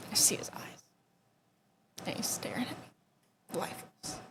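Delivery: very soft, nearly whispered recollection; hushed dread. Real, not acted. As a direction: let the voice sink almost to nothing. Recalls finding cousin's lifeless face.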